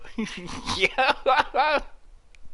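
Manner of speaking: high giggling fit; high giggle. Real, not acted. Hunter laughing at his own bad shots, high giggle. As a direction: giggle at own sloppy shooting.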